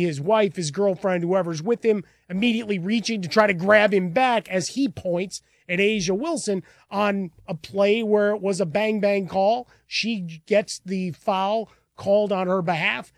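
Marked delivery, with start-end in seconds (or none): none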